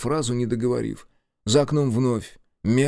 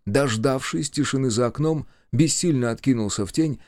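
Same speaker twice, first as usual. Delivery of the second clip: usual speaking voice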